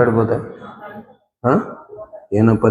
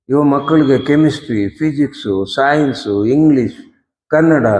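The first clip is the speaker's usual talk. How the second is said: ordinary talk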